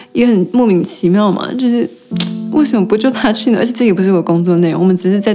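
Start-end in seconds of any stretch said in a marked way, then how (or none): none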